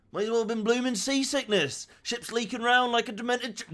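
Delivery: high, loud Somerset sailor voice; Somerset accent. Acted. Streamer voicing the ship's sailor despite refusing, Somerset accent. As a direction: slip into a Somerset sailor voice before stopping. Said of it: "no, I'm not doing it".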